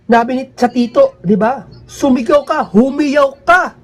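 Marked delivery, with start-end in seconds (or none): 1.9-3.8 preacher's tone. Retold or imitated. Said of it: "Yung boses nila may tono. Pat-, tumataas".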